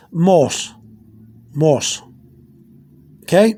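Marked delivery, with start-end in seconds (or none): 0.1-2.0 "mos" with two O's and no H sound; silent H. Shown as a demonstration. Teacher modelling the spelling M O H O S, silent H in "mos". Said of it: "the H is silent".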